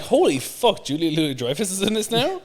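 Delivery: high, loud reenacted shock; retold astonishment. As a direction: exclaim it high and loud, reliving the surprise. Retells shock at surprise cameo.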